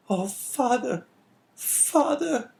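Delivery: put-on very high, tremulous girl's voice; tearful young girl. A narrator's reading. Daughter in father's arms, high and tearful. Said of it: "murmured she".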